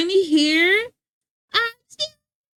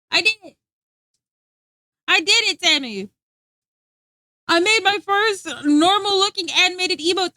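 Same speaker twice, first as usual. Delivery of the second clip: proud excitement; 5 semitones higher, 3 dB louder than usual. Real, not acted. Proud announcement.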